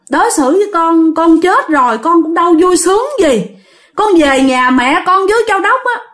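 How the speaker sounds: high, plaintive tale of woe; pleading. Acted. Homeless ghost pleads with the master, plaintive and high. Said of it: "Bắt đầu van xin".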